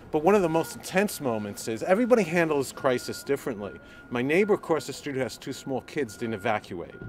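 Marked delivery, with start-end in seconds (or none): none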